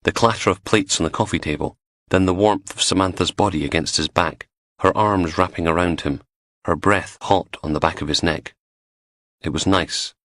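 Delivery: ordinary delivery, nothing marked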